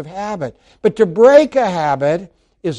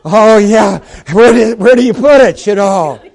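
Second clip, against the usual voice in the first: confused congregant; 3 semitones higher, 6 dB louder than usual. Retold or imitated. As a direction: act out a baffled congregant fumbling. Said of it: "Some are going like this".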